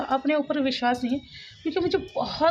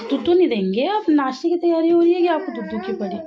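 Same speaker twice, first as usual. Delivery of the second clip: louder, higher mock telling-off; playful scolding; 5 semitones higher, 5 dB louder than usual. Real, not acted. Mother to child wanting milk before breakfast, playful scolding. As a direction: chide a little one in a raised voice.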